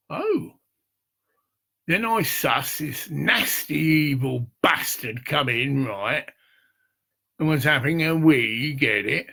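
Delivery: ordinary talk, nothing marked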